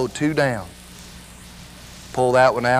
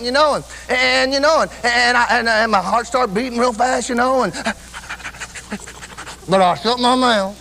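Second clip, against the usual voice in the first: impassioned preaching; 8 semitones higher than usual. Acted. Preacher telling the church how hard rejoicing was, impassioned. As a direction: preach it with rising fervor, stretching the big words.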